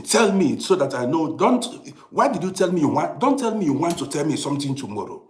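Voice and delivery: loud, heated rant at full force; a father fed up with delays, shouting his frustration; angry rant. Real, not acted. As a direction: vent loudly about being kept waiting. Ranting about procrastination.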